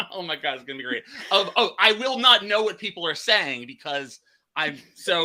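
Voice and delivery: laughing through the words; giggling nervously while she talks. Real, not acted. Host laughing about getting lost on her UK trip.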